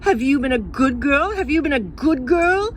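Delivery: grown-ups at the zoo nagging little Jane, loud and high. Retold or imitated. Zoo keepers nagging the child.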